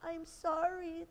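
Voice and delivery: high, soft, slow apology; meek storybook child; sorry little girl. A narrator's reading. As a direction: apologise small and high, drawing it out. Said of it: "the little girl said".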